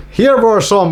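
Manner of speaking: ordinary talk, nothing marked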